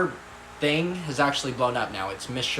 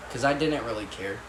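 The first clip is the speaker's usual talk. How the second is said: ordinary delivery, nothing marked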